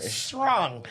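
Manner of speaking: "Strong" through tightened, crunched lips; tight-lipped Toronto slang. Shown as a demonstration. Showing off a favourite Toronto word, tight-lipped "Strong". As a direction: say "Strong" with the mouth scrunched. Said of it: "my favorite word".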